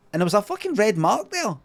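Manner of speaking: ordinary talk, nothing marked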